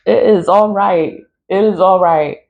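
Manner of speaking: ordinary delivery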